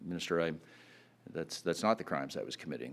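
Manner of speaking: retold sheepishly, low and soft; low, hesitant, halting mumble of a mild confession; sheepish admission. Young man sheepishly correcting the minister about his crimes, low and halting. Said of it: "Colin very sheepishly, put down his head".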